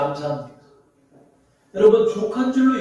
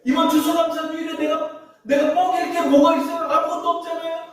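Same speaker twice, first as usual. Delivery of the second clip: much higher, whiny begging of God; greedy prayer; 9 semitones higher than usual. Retold or imitated. Pastor mocking people who pray for more, high and whiny. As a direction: plead like a greedy child. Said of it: "막 이런 기도하는 사람 많아요".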